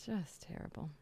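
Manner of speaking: whispered mock disapproval; soft whispered aside. Real, not acted. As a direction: drop to a whisper, tut-tutting.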